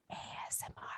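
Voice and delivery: soft, quick ASMR whisper. Shown as a demonstration. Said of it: "just whispering".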